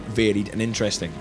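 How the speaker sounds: ordinary talk, nothing marked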